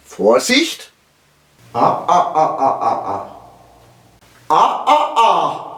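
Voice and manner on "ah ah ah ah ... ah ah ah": quick Ah, ah of caution; Ah, ah as a warning. Shown as a demonstration. Teacher showing caution, warning Ah, ah. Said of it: "Hinweis, vorsichtig zu sein".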